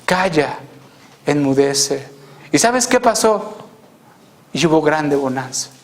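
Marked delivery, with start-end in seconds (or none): 0.1-2.1 calm, low command without force; very tranquil. Retold or imitated. The Lord, woken by disciples, calms the sea with great tranquility. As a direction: say it low and untroubled. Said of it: "dice con mucha tranquilidad".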